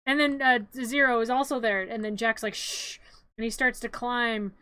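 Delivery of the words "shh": soft unvoiced hush; imitated shushing. Playing Jack hushing as he climbs down, soft shush. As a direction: hush someone with a shh.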